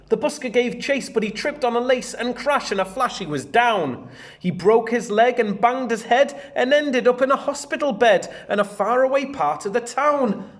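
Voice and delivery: put-on lively read-aloud to children; bouncy storybook narration; story reading. A narrator's reading. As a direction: read it out like a picture book for the class. Teacher reads picture book aloud.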